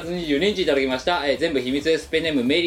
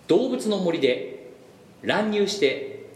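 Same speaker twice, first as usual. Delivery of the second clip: slow reading; 3 semitones higher, much slower than usual. Acted. Reading a listener entry.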